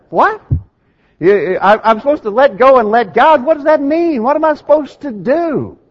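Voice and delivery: incredulous disbelief. Retold or imitated. Preacher retelling his reaction to the sermon, incredulous. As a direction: sound stunned by the advice. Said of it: "I just shook my head like".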